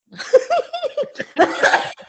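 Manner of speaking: high, loud burst of laughter; real high-pitched laugh. Real, not acted. Laughs at co-host's teasing remark.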